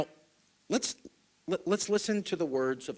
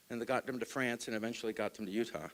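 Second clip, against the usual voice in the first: normal talking voice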